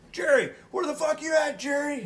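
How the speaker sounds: very high, loud yell into the dark; panicked shout. Retold or imitated. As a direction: call out for your missing partner in terror. Lost in the dark attic.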